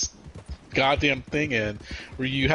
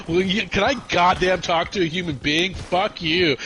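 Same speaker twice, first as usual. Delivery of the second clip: higher, frustrated mock outburst; moderately raised, indignant cursing; retold yell; 7 semitones higher, 4 dB louder than usual. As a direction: re-enact swearing at a phone robot. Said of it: "I think I yelled".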